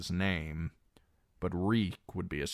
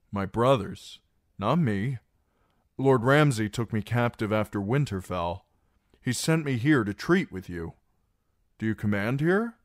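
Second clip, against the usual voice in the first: louder, higher pressing talk; urgent explanation; 5 semitones higher, 5 dB louder than usual. Acted. Reek explains himself to the guard, urgent and louder. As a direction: press the story hard.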